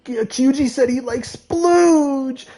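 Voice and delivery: mock a friend by calling it out to everyone; teasing kid announcement. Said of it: "he's like".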